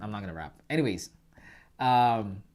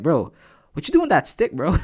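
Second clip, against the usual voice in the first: high, loud mock teasing from the Toronto kids; ribbing the new kid, pitched way up; 4 semitones higher, 9 dB louder than usual. Toronto kids ribbing the hockey player, loud high mock teasing.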